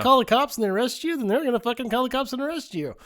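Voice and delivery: high, fast, heated retort; retold angry dad. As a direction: snap back at the wife in the store. Dad furious at the store.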